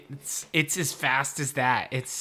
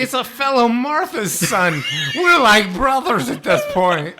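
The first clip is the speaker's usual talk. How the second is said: loud, high, drawn-out shout; hammed-up slow, excited yelling; mock overjoyed Batman; 10 semitones higher, 12 dB louder than usual. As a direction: proclaim sudden brotherhood at full volume. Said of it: "Batman's just like".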